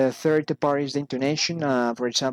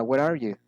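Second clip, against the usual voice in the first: ordinary delivery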